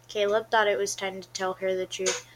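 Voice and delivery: ordinary delivery, nothing marked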